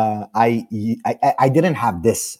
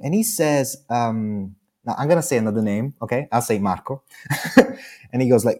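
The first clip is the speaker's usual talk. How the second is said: ordinary delivery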